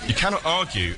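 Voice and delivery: ordinary talk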